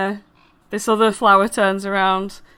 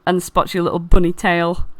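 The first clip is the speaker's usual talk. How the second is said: ordinary talk, nothing marked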